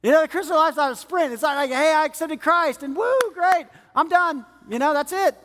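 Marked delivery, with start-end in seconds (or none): 1.7-5.4 mock overexcitement. Mock new convert, overexcited.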